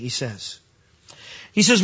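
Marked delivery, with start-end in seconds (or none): none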